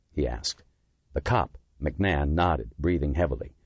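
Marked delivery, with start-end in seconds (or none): none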